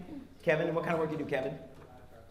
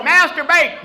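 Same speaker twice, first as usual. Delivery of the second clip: high, loud comic outburst; yelled; 9 semitones higher, 8 dB louder, slower than usual. Retold or imitated. Waiter yells at a diner ordering potato, comic high yell. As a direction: blurt it out as a mock waiter's yell. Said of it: "He keeps going".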